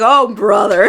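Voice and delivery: loud, fed-up groan of a phrase; exasperated. Retold or imitated. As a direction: roll your eyes at them. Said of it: "you're like".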